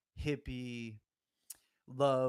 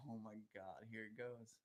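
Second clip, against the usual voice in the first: barely voiced, softer, lower awe; hushed awe; 3 semitones lower, 14 dB quieter than usual. Tripper hushed at the mountain wiggling, awed. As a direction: breathe it out as the trip starts. Retold trip onset, hushed.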